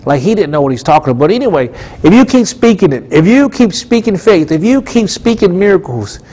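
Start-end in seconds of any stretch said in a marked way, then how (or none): 2.0-6.2 higher-pitched, insistent repeated phrases; rhythmic preaching. Real, not acted. Preacher urging listeners to keep speaking faith, insistent preaching. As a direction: drive each repeated clause home.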